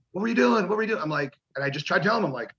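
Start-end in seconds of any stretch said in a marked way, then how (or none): 0.1-1.0 higher, demanding mock anger; livid. Boss Bruce confronts him after the pyro, mock livid demand. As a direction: imitate the boss grilling him. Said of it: "just freaking livid".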